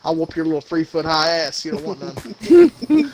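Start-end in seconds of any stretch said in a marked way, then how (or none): 1.7-3.1 mock tough taunt. Taunting Tom Cruise, tough guy.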